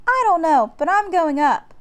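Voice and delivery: put-on a girl deciding to climb, eager. A narrator's reading. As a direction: announce it with daring glee. Annie bold and eager.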